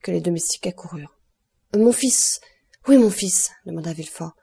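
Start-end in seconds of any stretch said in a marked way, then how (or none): 1.8-3.5 loud, higher cry for his son; raised, anguished outburst; frantic demand. A narrator's reading. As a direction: demand the boy urgently.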